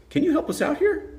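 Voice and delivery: high-pitched imitation of a guy asking; mimicked plea. Guy flags down restaurant worker, high mock plea. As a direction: put the request way up high.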